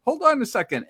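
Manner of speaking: high-pitched mock Japanese accent; imitated Japanese accent. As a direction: play a hotel clerk with a Japanese accent. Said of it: "in their, the accent".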